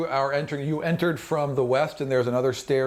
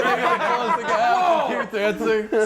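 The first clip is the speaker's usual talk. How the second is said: laughing narration; 9 semitones higher than usual. A narrator's reading. GM narrates comic dancing corpse scene.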